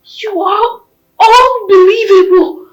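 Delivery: crying. Acted. Wife discovers betrayal, breaks down.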